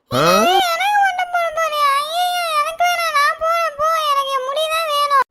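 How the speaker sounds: put-on high wailing little girl, crying and shouting; crying child protest. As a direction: sob and yell that she won't be shaved. Girl refuses head shaving, runs off.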